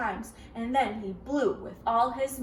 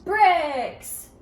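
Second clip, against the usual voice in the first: loud, higher exclaimed Bricks; excited answer; 5 semitones higher, 11 dB louder than usual. Real, not acted. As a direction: call it out with delight. Reader confirms child's answer.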